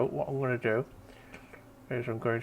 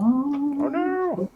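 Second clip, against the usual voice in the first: mock dismay; 5 dB louder than usual.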